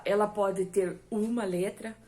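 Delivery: normal talking voice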